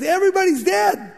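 high, frantic cry of a panicked messenger. Acted. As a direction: blurt it out in alarm. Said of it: "the guy came so panicked".

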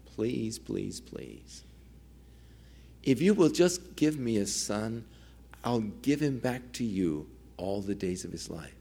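soft, pleading plea to the Lord; hushed, pleading mother's prayer. Retold or imitated. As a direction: beg quietly, pouring out her heart. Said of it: "as she was pouring out her soul to the Lord".

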